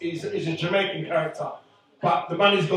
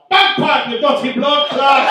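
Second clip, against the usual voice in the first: put-on loud, high Bajan accent with a laugh; Bajan shout; 8 semitones higher, 7 dB louder, slower than usual. Bartender character yelling his line in Bajan accent. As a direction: yell it in full Bajan. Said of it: "I'm like".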